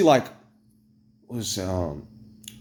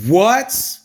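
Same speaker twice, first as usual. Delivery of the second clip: high, louder incredulous what; shocked what; 7 semitones higher, 4 dB louder than usual. Real, not acted. After the depression email arrives, shocked disbelief. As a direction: throw it out in shock.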